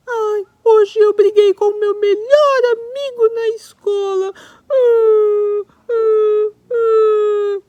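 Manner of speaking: high, loud hammed-up puppet sobbing; crying monkey. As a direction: sob as the sad little monkey. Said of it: "macaquinho Simão chegou triste".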